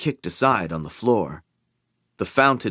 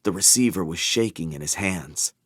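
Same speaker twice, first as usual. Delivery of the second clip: ordinary delivery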